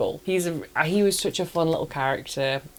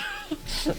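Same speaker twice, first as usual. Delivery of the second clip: breathy laugh; 7 dB quieter than usual. Real, not acted. Laughing at own reaction.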